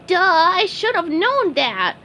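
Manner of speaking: high, slow goofy self-scold; high goofy sponge voice. Acted. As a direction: slap your forehead goofily.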